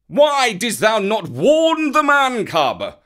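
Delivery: slow, angry roar. A narrator's reading. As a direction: roar at him in fury. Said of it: "he roared".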